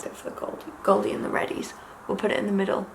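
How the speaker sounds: very soft, half-whispered aside; muttering to self. Real, not acted. Talking to herself about eye colours, soft muttering. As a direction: drop to a quiet murmur while deciding.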